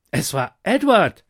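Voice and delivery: loud jester voice pointing the finger; jester's accusing call. Acted. Jester accuses the taster, loud and pointed. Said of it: "rief der Hofnarr".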